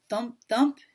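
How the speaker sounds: plain reading voice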